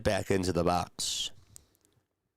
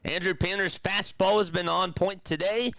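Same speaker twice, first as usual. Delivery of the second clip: higher-pitched announcer's strikeout call; live play-by-play; 6 semitones higher than usual. Real, not acted. Streamer calls Painter's third strikeout, in announcer play-by-play. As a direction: lift the call as the batter whiffs.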